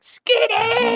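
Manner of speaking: very high laughing shriek; mom shrieking. Retold or imitated. Mom opening the door on the fight, high laughing shriek. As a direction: shriek the nickname, half laughing. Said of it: "She's like".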